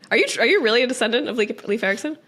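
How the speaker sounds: ordinary talk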